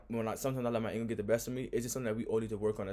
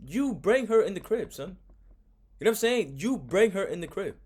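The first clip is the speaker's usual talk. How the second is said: incredulous; 8 semitones higher, 5 dB louder than usual. Real, not acted. Host scolding her for bringing the woman inside, incredulous. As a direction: hammer the point home in disbelief.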